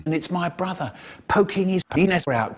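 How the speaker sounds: ordinary delivery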